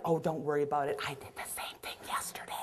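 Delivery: hushed, breathy confiding; reassuring whisper. Retold or imitated. Caregiver whispering to a resident to save face. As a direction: whisper it like a shared secret.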